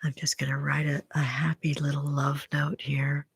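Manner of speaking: soft whispered aside while writing. Real, not acted. Streamer writing a love note on camera, whisper. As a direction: murmur it under your breath, unvoiced.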